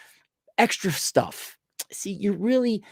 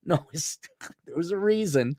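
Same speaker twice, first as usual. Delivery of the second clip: ordinary talk, nothing marked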